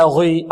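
غي with a too-heavy غ and dark vowel; over-thickened ghayn. Shown as a demonstration. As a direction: say غي exaggerating its heaviness. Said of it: "أعطيناها مرتبة أكثر". (غي بمبالغة في التفخيم)

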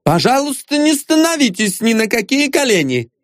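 high, stern female command; imperious high-pitched voice; menacing woman. A narrator's reading. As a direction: order him off his knees indignantly. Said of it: "сказала она грозно".

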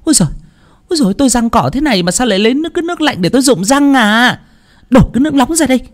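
very high, fast, grating complaint; shrill hiss. A narrator's reading. Mother-in-law screeches at her daughter-in-law about water. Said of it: "rít lên khe khé".

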